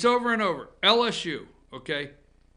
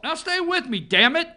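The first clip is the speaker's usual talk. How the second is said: much higher and louder, demanding; insistent outburst; 9 semitones higher, 8 dB louder than usual. Real, not acted. Host demanding listeners stay with him, loud and high. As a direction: grab them by the collar with your voice.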